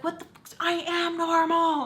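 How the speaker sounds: high, offended mock reply; imitating her outraged comeback, high and sharp; mock indignant girlfriend. Retelling an argument, she mimics her partner's high indignant reply.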